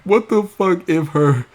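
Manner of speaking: high squeaky mock wail; imitating Raven. Raven mocked, wailing about Wattpad failing. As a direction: whine it like a hammed-up tantrum. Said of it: "she will take it out on the producers".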